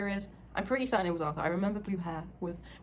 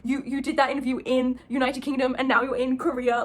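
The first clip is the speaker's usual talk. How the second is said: louder, slightly higher retold amazement; freaking out; 4 semitones higher, 6 dB louder than usual. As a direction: imitate someone astonished to see you. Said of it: "he was fully freaking out".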